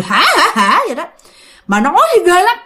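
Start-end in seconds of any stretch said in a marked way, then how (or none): 0.0-0.8 a creepy ha ha ha; mock the spirit's cackle; possessed man's short eerie laugh. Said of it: "vừa nói mà vừa cười".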